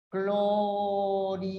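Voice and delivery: "Clorhidrato" slowly chanted in separated syllables, clo-rhi-dra-to; sing-song each syllable apart. Shown as a demonstration. Teacher showing students how to split the word, sing-song. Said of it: "¿cómo separas".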